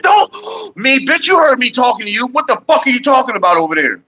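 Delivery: high, heated retelling of yelling at the nurse; retold angry yell. Son retells cursing out the nurse, high and heated. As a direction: replay telling her off, high and hot. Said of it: "I said".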